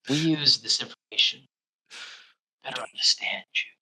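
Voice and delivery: canned line fading to a whisper; mock whisper. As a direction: whisper the company line mockingly. Mocking canned rep response.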